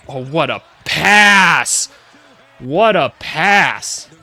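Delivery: slow, drawn-out exclamation, punched loud on PASS; amazed praise. Real, not acted. Fan marveling at a great pass, drawn-out amazed praise. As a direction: stretch out the praise in awe.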